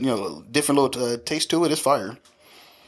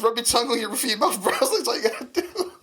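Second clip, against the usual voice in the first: high-pitched teasing through laughter; laughing; 12 semitones higher, faster than usual. Retold or imitated. Son retelling his denture joke on mom, laughing. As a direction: giggle your way through the advice. Teasing retold while cracking up.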